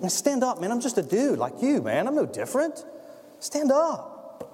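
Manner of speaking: high, laid-back everyman voice; casual Peter. Acted. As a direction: talk like a regular dude. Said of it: "Peter says".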